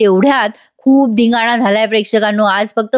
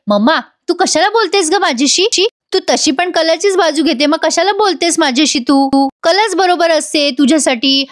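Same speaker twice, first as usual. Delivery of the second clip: put-on higher, complaining girl's voice; whiny sulking daughter; 6 semitones higher than usual. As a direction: sulk at mum as the spoilt younger sister. Naina whines at mother. (आईवर रुसलेल्या मुलीचा उंच, तक्रारीचा आवाज)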